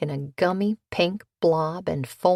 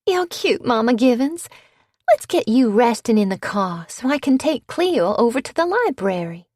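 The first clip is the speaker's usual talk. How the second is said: higher, louder sugary coaxing; sugary belle; 5 semitones higher, 5 dB louder than usual. A narrator's reading. Bitsy coaxing her mother-in-law in a higher, louder voice. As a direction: sweet-talk the old lady.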